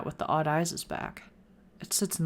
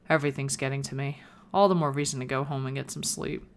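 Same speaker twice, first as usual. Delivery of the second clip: normal talking voice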